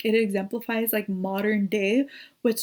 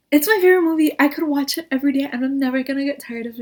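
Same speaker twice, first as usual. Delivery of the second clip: high, loud and fast; excited gushing; 5 semitones higher, 6 dB louder, faster than usual. Real, not acted. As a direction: gush about it with delight. Gushing about favorite movie.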